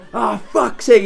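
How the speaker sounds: mock frustration of a furious friend; imitating tamping mate. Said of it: "he was tamping".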